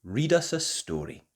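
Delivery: usual speaking voice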